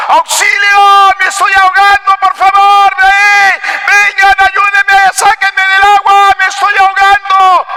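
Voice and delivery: high, drawn-out cries for help; mock drowning shouts. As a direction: yell for rescue as the boy pretending to drown. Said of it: "y comenzaba". (gritos fingidos de auxilio)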